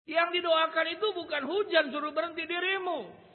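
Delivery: high, loud challenge to the congregation; full-force preaching. Real, not acted.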